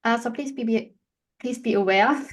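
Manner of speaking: ordinary delivery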